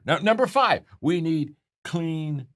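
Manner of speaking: high, loud NUMBER FIVE, then a long drawn-out "clean"; emphatic list climax. Retold or imitated. Building repeated list to climax.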